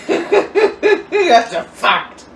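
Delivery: high, loud laughing squeal; cracking up, voice shooting way up. Real, not acted. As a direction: squeal it out slowly through laughter. Cracking up at own joke.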